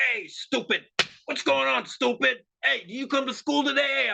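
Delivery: high-pitched mocking bully taunt; bullying jock. Jock taunting a kid at school, bullying and high-pitched. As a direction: needle the victim like a school bully. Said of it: "Donald Trump's more like the jock".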